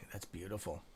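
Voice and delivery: low, soft murmur of approval; quiet admiration. Real, not acted. Streamer watching the stock move, quietly admiring. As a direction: say it softly, almost to yourself. First, low reaction to the screen.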